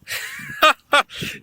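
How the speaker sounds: high burst of laughter; amazed laugh. Real, not acted. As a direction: laugh in disbelief. Laughing at the find.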